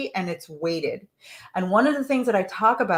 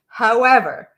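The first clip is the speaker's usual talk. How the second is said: "However" punched louder; stressed however; 2 semitones higher, 6 dB louder than usual. Shown as a demonstration. Coach reading the letter, stressing "However". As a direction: lean into "However" as the telling word. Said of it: "those buts and those howevers".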